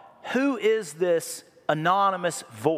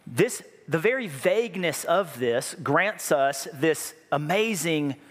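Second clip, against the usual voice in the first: normal talking voice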